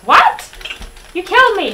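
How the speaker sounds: loud, high disbelief; indignant outburst. Real, not acted. Player killed by his friend, loud indignant protest. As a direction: protest it like you can't believe it.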